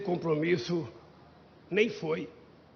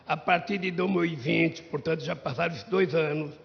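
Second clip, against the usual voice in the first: ordinary delivery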